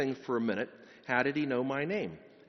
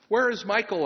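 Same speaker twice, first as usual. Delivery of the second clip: higher, louder urgent call; urgent question; 7 semitones higher, 7 dB louder than usual. Acted. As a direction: ask urgently, high and loud. Wounded soldier wakes, asks urgently.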